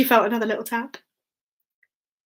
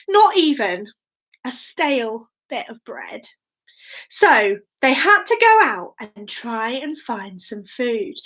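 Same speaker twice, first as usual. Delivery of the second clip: ordinary delivery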